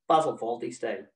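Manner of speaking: usual speaking voice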